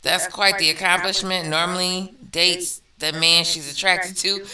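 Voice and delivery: ordinary talk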